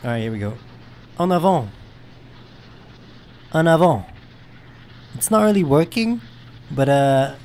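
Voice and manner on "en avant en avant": loud, higher call; firm shouted command. Real, not acted. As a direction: call out an order to the dogs. Calling commands to game dogs.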